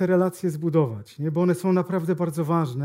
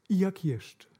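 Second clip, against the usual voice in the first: mock quiet question, softer; quiet boss; 7 dB quieter, slower than usual. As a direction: say "I jak jeszcze?" softly and evenly, as a calm boss.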